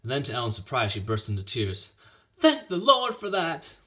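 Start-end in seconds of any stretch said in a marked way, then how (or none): 2.4-3.8 high, loud, slow outburst; high-pitched, overwrought thanks; tearful relief. A narrator's reading. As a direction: wail it with relief, drawn out. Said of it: "as she roughly brushed her tears away".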